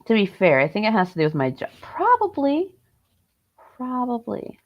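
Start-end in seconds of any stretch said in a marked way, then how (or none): 1.8-2.7 high, rising, unsure Probably; Probably tossed up high as a guess; high questioning probably. Pretend. Repeating the word two ways. 3.8-4.6 settled probably. Pretend. Repeating the word two ways.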